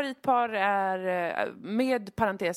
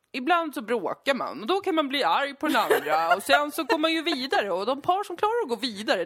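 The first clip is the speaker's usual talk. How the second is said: higher, louder mock-expert voice; imitating the TV experts; 6 semitones higher, 5 dB louder than usual. Experts explaining conflict in a relationship, hammed-up imitation. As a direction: parody an expert dispensing relationship wisdom. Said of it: "som de bara".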